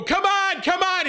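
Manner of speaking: very high-pitched mock cheering; urging on. Friends pushing him to finally jump, urging on. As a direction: imitate friends egging someone on, high.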